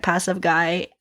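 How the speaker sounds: ordinary talk, nothing marked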